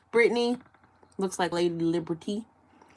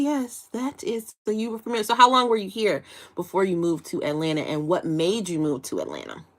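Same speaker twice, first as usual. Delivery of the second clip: ordinary talk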